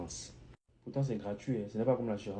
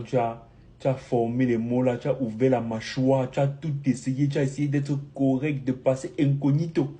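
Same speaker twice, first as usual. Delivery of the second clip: loud, emphatic, piling up each effort; mock-exasperated protest; 2 semitones higher, 8 dB louder than usual. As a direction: list every effort loudly, as if cheated. Mock lament over being found out.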